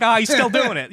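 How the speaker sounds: high-pitched mock exclamation; mocking bar patron. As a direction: act the scoffer pointing someone out, pitched well up. Bar patrons scoff at the local.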